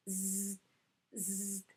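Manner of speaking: soft buzzing zzz; bee buzz. Shown as a demonstration. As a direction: buzz gently like a passing bee. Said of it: "it sounds like zzz".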